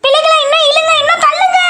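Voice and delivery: put-on rally the kids with a strained high shout; excited cheering on. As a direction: pretend rescue, urging the kids to pull harder, high and shouting. Urging kids to pull the whale.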